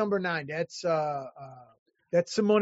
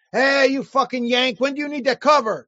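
lightly voiced English artist badgering by phone; imitated rude caller; 4 semitones higher, 5 dB louder than usual. English artist calling at two in the morning, imitated rude caller. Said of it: "He called me up. He goes, at two in the morning".

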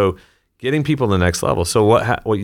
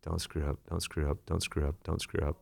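much softer, nervous self-talk; anxious inner voice; 3 semitones lower, 14 dB quieter than usual. Retold or imitated. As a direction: mutter it low like a scared kid. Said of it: "what is he thinking the whole time".

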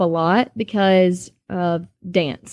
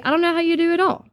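higher, quick, a hint of gushing admirers; admiring acquaintance; 9 semitones higher, faster than usual. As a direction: people marveling at the busy mom. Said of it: "People would say".

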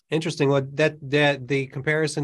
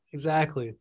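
normal talking voice

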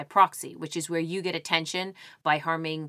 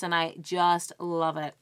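ordinary talk

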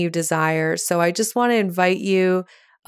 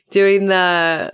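usual speaking voice

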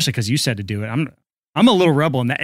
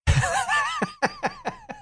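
long high-pitched burst of laughter; 6 dB quieter than usual. Real, not acted. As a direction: laugh at the lame dad. Co-host laughs at dad.

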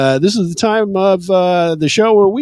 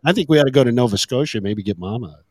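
ordinary delivery

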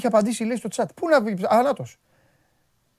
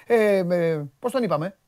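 ordinary talk, nothing marked

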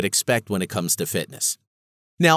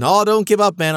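friend cheering; 10 semitones higher, 6 dB louder than usual. Retold or imitated. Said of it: "he's going".

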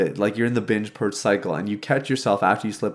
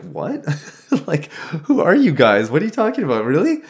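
incredulous laughing dad; 6 semitones higher, 3 dB louder than usual. Retold or imitated. Dad incredulous at kids' reason.

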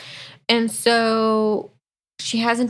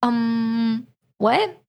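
confused what. Retold or imitated. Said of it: "She's like".

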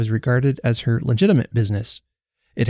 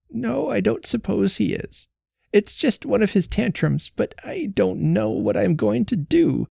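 put-on fretful old woman; 9 semitones higher than usual. A narrator's reading. Old Betsy frets to Jenny about her sulking husband, high and anxious. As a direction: let an anxious old wife's voice quaver.

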